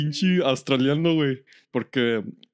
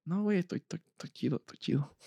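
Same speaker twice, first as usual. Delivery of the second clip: retold self-reassurance; 5 semitones higher, 8 dB quieter than usual. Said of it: "Pero me digo".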